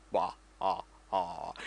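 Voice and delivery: breathy villain cackle, soft; a quick hammed-up evil chuckle; mock evil laugh. Villain laugh after Vader line.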